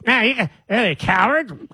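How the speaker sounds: mock taunt. Mocking podcaster's taunt.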